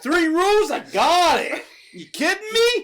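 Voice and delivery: loud, high mock bravado; cocky dismissal. Buyer brushing off the rules, cocky dismissal. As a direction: brush it off in an exaggerated high shout.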